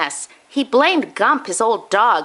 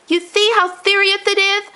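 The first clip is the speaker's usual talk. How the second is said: Grandpa moaning; 12 semitones higher than usual. Acted. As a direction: lament like a grandpa who lost his teeth. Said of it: "moaned Grandpa".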